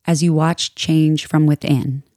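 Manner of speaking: calm, measured meditation voice with long pauses; soothing yoga teacher guiding a meditation; guided meditation. Acted. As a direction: ease listeners into stillness, unhurried and even.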